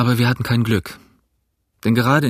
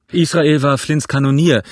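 calling out loud and high; 4 semitones higher, 5 dB louder than usual. A narrator's reading. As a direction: shout the line across the deck. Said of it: "sagte Gray mit eigener Stimme".

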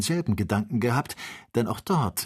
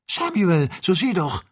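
loud, high-pitched cry of amazement; excited shout to look; urgent call; 11 semitones higher, 5 dB louder than usual. A narrator's reading. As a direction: call out insistently to a friend. Kennedy urgently calls his friend to look.

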